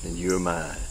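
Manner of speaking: soft, slow prayer for the congregation to repeat; gentle repeat-after-me prayer; led prayer. Acted. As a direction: pray it quietly and sincerely. Leads prayer.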